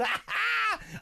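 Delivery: short, high burst of laughter. Real, not acted. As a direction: cackle at your own joke. Host cracks up at own joke.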